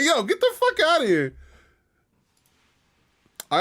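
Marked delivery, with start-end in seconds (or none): 0.0-1.4 much higher, laughing get out; high giggly mock dismissal; laughing brush-off. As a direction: squeak it out through a laugh. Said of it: "I'll be like".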